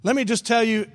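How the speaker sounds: normal talking voice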